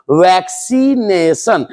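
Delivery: वैक्सीनेशन stretched out syllable by syllable; slow pronunciation demo. Shown as a demonstration. Teacher showing students how to pronounce it, slow sounding-out. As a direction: sound out वैक-सी-ने-शन slowly, each vowel long. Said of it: "आखिर इसको बोलेंगे कैसे".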